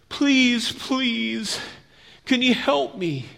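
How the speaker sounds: high, drawn-out begging; pleading. Acted. Wounded man begs the passing priest for help, pleading. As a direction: beg for help with long, high pleas.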